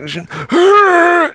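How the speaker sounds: sharp dramatic gasp, high pitched; loud mock gasp. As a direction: gasp theatrically. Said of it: "Was it too much".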